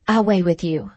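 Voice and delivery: ordinary delivery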